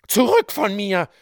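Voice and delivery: woman screaming in wild rage at the judge; furious scream. A narrator's reading. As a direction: hurl him away with rage. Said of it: "schrie aber das Weib in wilder Wut".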